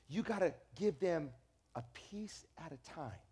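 a hint of a calm mother explaining softly; mother's gentle advice. Mother's advice retold quietly.

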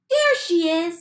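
excited chick. A narrator's reading. Baby bird spots the boat, high and excited. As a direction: spot her and light up. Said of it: "said the baby bird".

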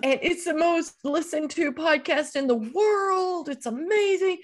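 high, stretched-out gushing; imitated incredulous sons. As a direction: lecture a clueless mom in mock outrage. Imitating sons' awe at the podcast.

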